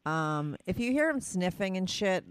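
ordinary talk

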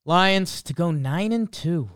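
usual speaking voice

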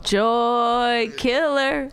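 very slow sing-song jeer; drawn-out taunt. Real, not acted. Wife heckling the husband, drawn-out taunt. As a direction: hold each word long, teasing.

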